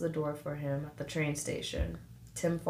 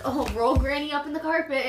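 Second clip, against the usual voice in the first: mock cheerful family voice; 7 semitones higher, 4 dB louder than usual. Voicing the family's game.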